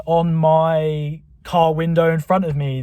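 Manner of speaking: ordinary talk, nothing marked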